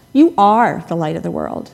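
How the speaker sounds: "are" punched loud after "You"; stress on ARE. Shown as a demonstration. Preacher recalling a childhood word game, stress on "are". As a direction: lean hard on the second word, "are". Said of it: "and then the second".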